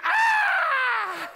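long, very high scalded scream; full-out comic shriek of pain. Acted. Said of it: "I'm screaming".

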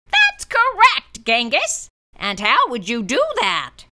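stern monster teacher. A narrator's reading. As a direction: question him like a strict teacher. Mister Z voiced.

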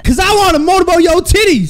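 loud high holler; shout. Real, not acted. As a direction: yell it cheekily. Calling after girl.

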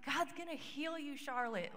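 high, eager declaration; telling Charlotte she will be healed; tearful excitement. Retold or imitated. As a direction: promise it through happy tears. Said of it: "I'm, like, crying".

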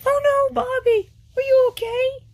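very high, loud alarmed cry; squealed, frantic concern; panicked. Acted. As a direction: panic over an injured friend. Panics over injured friend.

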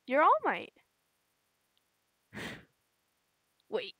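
ordinary talk

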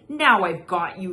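loud triumphant growl. Acted. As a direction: growl it as you pin it. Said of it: "he growled".

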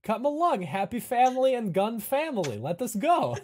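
high, loud rallying call; over-eager host herding guests; jolly camp host. Acted. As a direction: round up the families cheerily. Game master voices the camp host.

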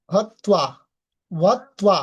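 হত্বা with the হ fully sounded; full h sound. Shown as a demonstration. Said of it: "উচ্চারণটা কি করতে হবে".

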